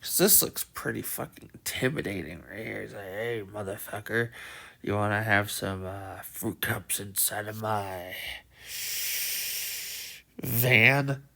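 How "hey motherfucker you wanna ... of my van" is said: low, quiet creepy stranger voice. Retold or imitated. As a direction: lure them in like a creepy van guy. Creepy giant voiced, mock van line.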